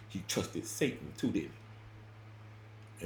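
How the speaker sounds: fading to a near-silent whisper; hushed, pointed whisper; whispered question. Retold or imitated. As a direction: let it drop into a whisper.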